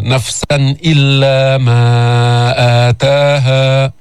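نفسا chanted with a crisp final n, no hum, before إلا; slow chant, clean n ending نفسا; clear nun. Shown as a demonstration. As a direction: sound the tanween of نفسا distinctly, then stretch the long vowels. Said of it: "أظهر،".

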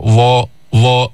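ظا with tongue tip touching the upper front teeth, emphatic, slow; slow heavy ظا, tongue just to the teeth; ẓāʾ demo. Shown as a demonstration. Teacher showing where ظ comes out, slow. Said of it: "ما يطلع لسانك كتير لبرا".

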